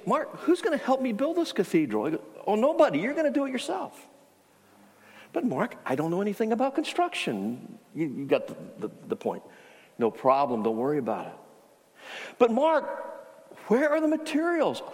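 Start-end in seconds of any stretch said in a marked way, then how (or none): 0.1-7.8 frustrated. Retold or imitated. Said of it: "you're getting more frustrated". 12.4-14.8 much higher, exasperated question; a rising, desperate plea to Mark; frustrated cry. Retold or imitated. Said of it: "you're getting more frustrated".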